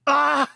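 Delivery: high, loud "ah"; hammed-up cry of surprise; mock amazed cry. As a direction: imitate someone's loud reaction. Retold reaction to the artwork.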